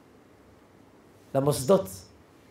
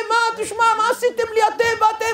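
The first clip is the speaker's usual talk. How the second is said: very high, outraged protest; imitated father's outburst; indignant father; 13 semitones higher than usual. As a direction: complain in a hammed-up high voice. Angry father on the phone.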